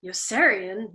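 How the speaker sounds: ordinary delivery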